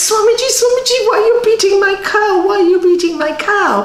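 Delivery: high, pleading neighbor's cry; upset neighbor. Retold or imitated. Neighbor begging the monk to stop beating his cow, high and pleading.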